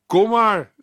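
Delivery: Jesus calling. Acted. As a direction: call him over the water. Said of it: "riep Jezus".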